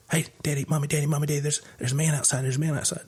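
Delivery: put-on a kid running in to warn Daddy and Mommy; nine-year-old son. Voicing his frightened young son.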